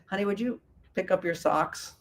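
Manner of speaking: softly, with a smile, honey would you pick up your socks; soft smiling request. Shown as a demonstration. Wife gently asks husband to pick up socks, softly smiling. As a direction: ask sweetly and gently, smiling. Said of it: "And smile".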